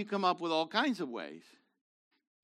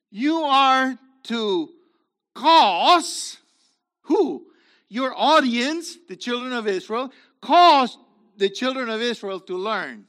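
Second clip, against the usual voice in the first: louder, drawn-out preacher's cry; forceful preaching; 3 semitones higher, 8 dB louder, slower than usual. Acted. Preacher declaring God's word to the congregation, forceful.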